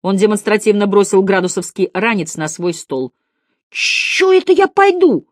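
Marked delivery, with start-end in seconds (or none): 3.7-5.3 cheeky pupil's exaggerated objection; wry defiance. A narrator's reading. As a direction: refuse the teacher in a high, loud voice. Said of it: "скривился Градусов".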